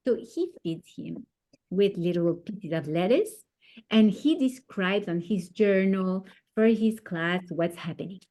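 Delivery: ordinary delivery, nothing marked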